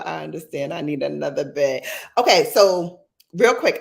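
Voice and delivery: normal talking voice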